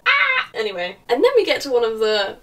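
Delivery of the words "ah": short high scream; loud yelp of cringing despair; exasperated scream. Real, not acted. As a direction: let out a high, frustrated shriek. Reacting to the cringy dialogue.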